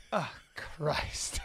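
imitated whispered gasp of disgust. Retelling the girl hit by the smell, appalled whisper. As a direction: whisper it under your breath, appalled.

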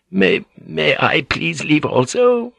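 slow, high, hesitant plea; weak old lady. A narrator's reading. Old lady asks permission with racing heart, weakly. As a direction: stumble timidly into the request. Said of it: "asked weakly".

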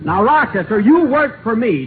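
ordinary delivery

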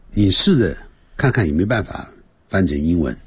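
ordinary talk, nothing marked

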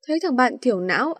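usual speaking voice

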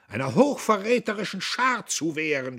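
put-on high, loud drunken yell; scream. A narrator's reading. Buck screaming back at Diederich. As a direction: scream it in frenzy. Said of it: "schrie Buck".

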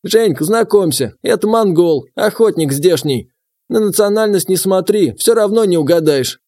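put-on gruff old gamekeeper; old man voice.

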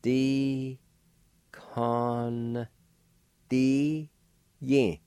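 "D, con, Di yin" in long, high, separate syllables; nasalized N in "con", held long; syllable-by-syllable pronunciation. Shown as a demonstration. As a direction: draw out each part of "D, con, Di yin" with pauses. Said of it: "with a K A like this".

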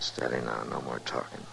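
low, soft, steady caution; hushed warning. Acted. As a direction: quietly hush your companion. Hushes companion as danger approaches.

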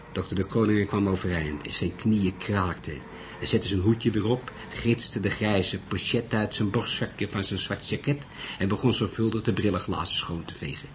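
usual speaking voice

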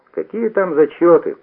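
high, loud breathless yell; out of breath from running, cry it out. A narrator's reading. Guzarchik runs up to the boys, yelling breathless. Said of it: "прямо завопил запыхавшийся Гузарчик".

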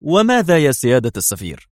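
sarcastic interruption; mocking. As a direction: scoff at him. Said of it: "قاطعه برونو ساخرا".

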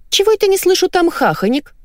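very high nagging voice; suspicious wife. A narrator's reading. Wife still suspicious about women's giggles, high-pitched nagging. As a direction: keep probing in a high voice. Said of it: "не успокоилась Валя".

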